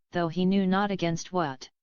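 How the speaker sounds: ordinary delivery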